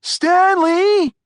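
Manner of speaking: high, loud holler of a name; yelling. A narrator's reading. Martin to Stanley still skating on the pond, yelling. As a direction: holler to a friend far off. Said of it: "yelled Martin".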